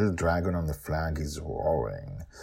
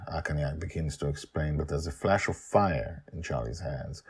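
usual speaking voice

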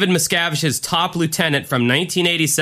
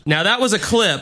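ordinary talk

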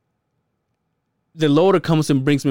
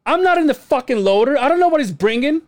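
raised voice; 11 semitones higher than usual. Retold or imitated. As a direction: snap back at the inspector in frustration. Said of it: "I raised my voice".

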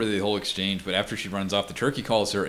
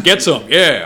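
higher, loud sports whoop; mock macho cheer; 4 semitones higher, 9 dB louder, slower than usual. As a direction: cheer like a football-mad dad. Mock football dad cheering.

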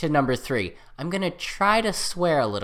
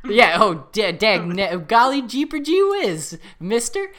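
put-on high, slow gee-whiz kid voice; wholesome 1950s kid; 6 semitones higher, 6 dB louder than usual. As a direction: ham up a clean-cut fifties boy. Mock clean-talk response to Darn.

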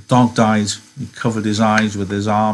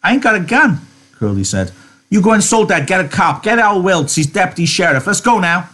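much higher, loud commanding voice; Curly barking orders; 10 semitones higher, 3 dB louder than usual. Acted. Curly orders the posse out, loud and furious. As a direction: take charge, furious and impatient.

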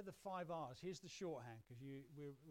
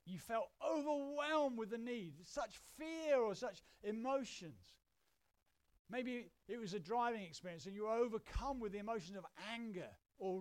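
loud, raised, emphatic preacher delivery; impassioned preaching; 6 semitones higher, 10 dB louder than usual. Real, not acted. Preacher urging listeners to recall desperate, angry moments, impassioned. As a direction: hammer the emotional words, voice lifted and loud. Preaches at full force on strong emotions.

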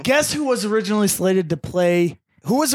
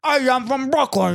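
put-on Brooklyn accent; 4 semitones higher than usual. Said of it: "bullshit Brooklyn accent".

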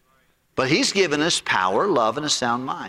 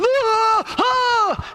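very high mock scream of someone being shocked; hammed-up electrocution cry; mock shocked yelp; 4 dB louder than usual. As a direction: yelp twice like you just got zapped. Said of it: "acted like he got shocked. He went".

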